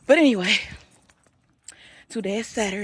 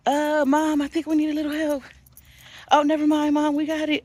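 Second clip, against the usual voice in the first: lightly voiced kids; 9 semitones higher, 4 dB louder than usual. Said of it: "They're like".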